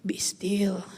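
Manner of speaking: low whispered command. Shown as a demonstration. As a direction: breathe the words out softly.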